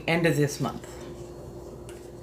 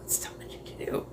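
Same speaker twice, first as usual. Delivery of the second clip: whispering; 11 dB quieter than usual. Pretend. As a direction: breathe it out like a secret. Repeats line in whisper.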